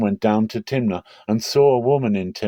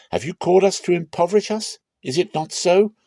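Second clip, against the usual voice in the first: higher and fast, accusing; indignant demand; 11 semitones higher, 4 dB louder than usual. Acted. Philistine men threatening Samson's wife over the riddle, fast and indignant. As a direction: fire the questions at her quickly.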